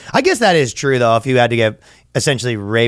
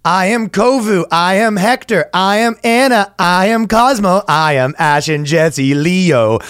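grand roll call; 6 semitones higher, slower than usual. Acted. Reading list of alters theatrically.

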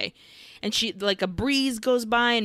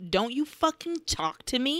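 higher-pitched mock fury without shouting; retold livid; 5 semitones higher than usual. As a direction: hold the anger in, no volume. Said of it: "Veronica is livid".

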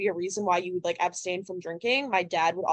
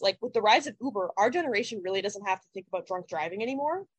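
normal talking voice

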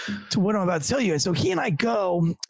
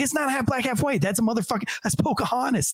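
high-pitched, incredulous retelling of an argument; mock-indignant, pitched up; exasperated correction; 6 semitones higher than usual. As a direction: argue the point in a raised pitch. Acted argument over a doll.

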